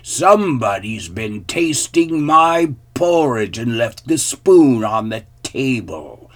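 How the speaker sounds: loud, great big growly voice; big bear's booming complaint; growling father bear. A narrator's reading. Father bear complains about his porridge, loud growl. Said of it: "growled the father bear in his great big voice".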